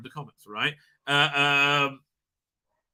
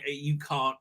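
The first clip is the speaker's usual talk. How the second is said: slow, soft, low challenge; calmly pin him with his own logic; hushed, deliberate rebuke; quiet defiance; 7 semitones lower, 9 dB quieter than usual. Retold or imitated. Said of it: "his daughter's like".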